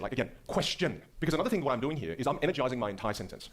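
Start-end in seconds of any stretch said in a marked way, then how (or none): 0.5-1.0 "question" slow, both syllables fully formed; enunciated. Shown as a demonstration. Coach demonstrating for a student, "question" enunciated. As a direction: spell out "question" with crisp, drawn-out care. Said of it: "I want you to enunciate".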